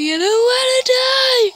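high, loud mock wail; hammed-up cry of fear; mock scared Slenderman. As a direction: wail it in pretend terror. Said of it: "He's like".

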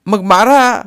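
high, loud challenge; defiant outburst. A narrator's reading. Crying wife daring her husband, defiant outburst. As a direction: throw the dare back at him.

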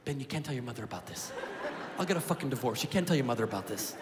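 hushed nervous warning; nervous dad. Retold or imitated. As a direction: keep it low and secretive. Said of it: "he goes".

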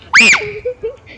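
loud, high-pitched laughter. Real, not acted. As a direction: burst out in a high cackle. Reacting during the game.

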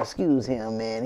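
ordinary delivery

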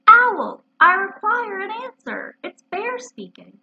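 high, drawn-out call to someone indoors; loud calling out. Acted. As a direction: call out slowly, pitched well up. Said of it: "called out in a very loud voice".

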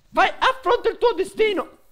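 high, loud dramatic challenge; mock epic challenge. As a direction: declaim it like a movie villain.

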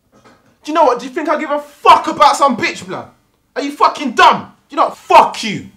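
angry phone threat. Acted.

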